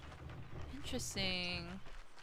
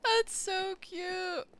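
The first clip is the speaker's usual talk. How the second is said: cooing; 9 semitones higher, much slower than usual. Real, not acted. Cooing at babies.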